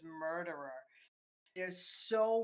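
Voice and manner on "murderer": normal talking voice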